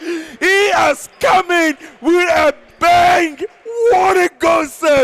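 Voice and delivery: slow, high, roaring goal call; goal shout. Real, not acted. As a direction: stretch every word out in triumph. Last-second goal, commentator erupts.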